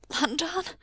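sudden high cry of a name, choked with shock. A narrator's reading. Husband cries out on seeing him. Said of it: "Wei Ying choked".

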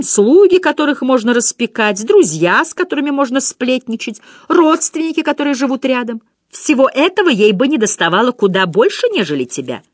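high, loud taunting imp voice; mocking little imp. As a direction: needle him like a cheeky devil. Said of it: "маленький бесёнок". (дразнящий бесёнок, высоко и громко)

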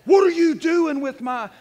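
loud, slow mock scolding from his mother; hammed-up yell of an angry mom; mother's cussing fit. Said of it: "she's like".

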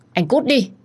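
very high, loud scream of get out; furious wife screaming him away. A narrator's reading. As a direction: shriek it at the door. Repeated order to leave.

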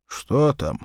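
ordinary delivery

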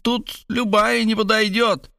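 high, slow pondering; preoccupied high-voiced remark; concerned. A narrator's reading. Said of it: "озабоченно отметила она".

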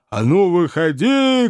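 shouted challenge. A narrator's reading. Cabman still whirling his fists, drawn-out shouted challenge. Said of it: "кричал кэбмен".

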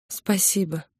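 soft, hushed thank-you; whispered thanks. A narrator's reading. She thanks Victor while embracing him, whispering. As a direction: murmur it gratefully, very softly. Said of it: "шепнула я".

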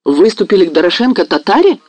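alarmed cry, pitched high; cried out. A narrator's reading. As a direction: exclaim in sudden alarm. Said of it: "вскрикнул Мазепа".

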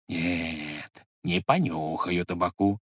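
soft, low private thought; thinking to himself. A narrator's reading. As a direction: say it inwardly, wary and hushed. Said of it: "подумал дед".

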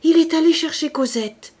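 loud, high cry of joy; elated outburst, voice lifted high; joyful exclamation. A narrator's reading. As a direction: cry it out, overjoyed. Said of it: "s'écria-t-elle".

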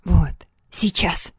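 slow, breathless whisper; excited whisper. A narrator's reading. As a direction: whisper it in thrilled anticipation. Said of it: "восторженно прошептал Вальдемар".

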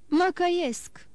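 a lightly voiced desperate cry; understated cry of repentance. A narrator's reading. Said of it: "strigă Danglars".

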